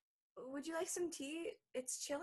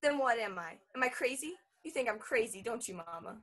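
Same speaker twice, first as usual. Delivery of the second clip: louder, hurt challenge; rising confrontation; 9 dB louder than usual. Acted. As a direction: throw it back at her. Bella confronts her mother.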